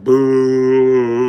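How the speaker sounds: loud crowd boo; a hammed-up crowd boo. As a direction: boo him like a whole audience. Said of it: "whole audience is like".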